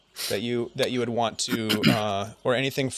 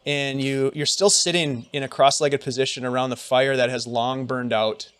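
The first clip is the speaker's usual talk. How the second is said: usual speaking voice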